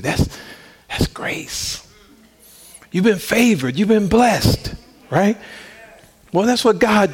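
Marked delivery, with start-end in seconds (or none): 0.0-1.8 breathy hush on grace; whispered. Real, not acted. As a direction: drop to a whisper for the key word. Hushed point on grace.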